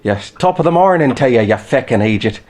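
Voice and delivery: key phrase in a put-on Irish accent; Irish dialect. Shown as a demonstration. Friend shows off an Irish dialect on request, hammed-up Irish accent. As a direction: lay on an amateur stage Irish brogue. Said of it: "do an Irish dialect".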